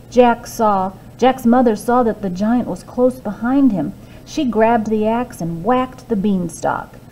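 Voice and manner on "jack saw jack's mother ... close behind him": usual speaking voice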